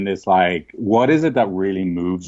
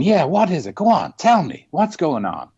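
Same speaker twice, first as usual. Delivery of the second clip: put-on higher, open, inviting the angry kid to talk; imitating mom meeting his fury head-on; mother's calm invitation; 8 semitones higher than usual. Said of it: "my mother just getting up and going".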